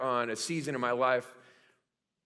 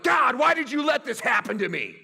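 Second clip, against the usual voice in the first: mock whiny complaint; 7 semitones higher, 6 dB louder than usual. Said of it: "we're like".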